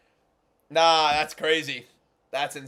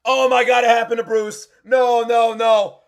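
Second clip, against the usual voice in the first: excited dismay; 4 semitones higher, 6 dB louder than usual. Real, not acted. Reacting again, excited.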